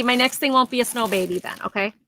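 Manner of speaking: usual speaking voice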